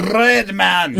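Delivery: high French impression, long rolled R on "red"; exaggerated French accent. Illustrating how French guys would say it, exaggerated French accent. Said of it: "get some French guys on and".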